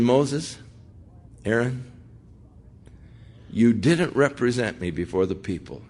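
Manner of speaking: soft, even reproach; a quiet, grave judgment without anger; calm and cool. Acted. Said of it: "God is calm and cool".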